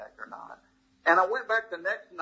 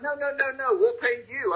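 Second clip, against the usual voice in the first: higher-pitched imitation of a publisher clearing up confusion; retold hasty correction; 8 semitones higher than usual. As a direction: lightly mimic a quick, high reassurance. Publisher hurriedly corrects his misunderstanding.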